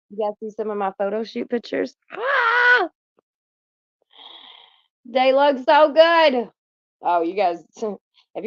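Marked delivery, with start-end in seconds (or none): 2.1-2.9 high delighted squeal; excited squeal. Real, not acted. Excited about photo shoot pictures. 5.1-6.5 slow, loud, higher gush; excited gushing. Real, not acted. Gushing over own photos.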